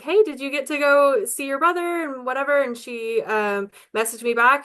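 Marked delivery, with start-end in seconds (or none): 0.0-2.6 higher and louder, bright friendly check-in; chirpy hey, reaching out to a stranger; upbeat friendly message voice. Retold or imitated. Reaching out to the sister online, friendly high call. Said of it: "I was like".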